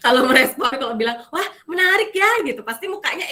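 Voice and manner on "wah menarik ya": higher, louder, eager; enthusiastic. Shown as a demonstration. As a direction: show real delight. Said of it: "suaranya juga pasti lebih semangat". (nada semangat dan ekspresif)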